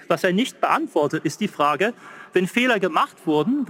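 ordinary talk

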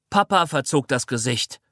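ordinary delivery

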